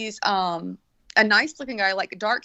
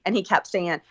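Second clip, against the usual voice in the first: normal talking voice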